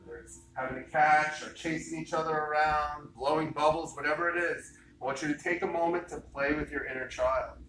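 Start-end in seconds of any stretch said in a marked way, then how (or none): none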